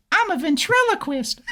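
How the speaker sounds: squeaky voice-throwing act; thrown ventriloquist voice. Retold or imitated. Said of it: "I was throwing my voice like".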